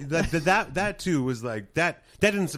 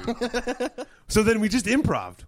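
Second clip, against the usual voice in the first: usual speaking voice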